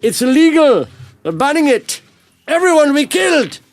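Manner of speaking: high, loud mock Indian accent; hammed-up Indian accent impression; dodgy Indian accent. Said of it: "Was that a dodgy accent you're putting on there?".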